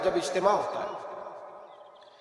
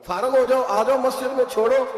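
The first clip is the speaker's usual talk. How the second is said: mock exhortation; 8 semitones higher, 5 dB louder than usual. Mock advice to hoarding elders.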